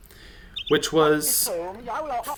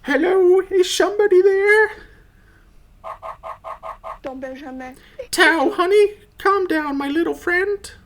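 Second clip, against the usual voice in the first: put-on very high, sweet old granny; grandma voice. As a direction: squeak it out like a doting grandma. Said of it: "I thought I would be good at doing a grandma voice".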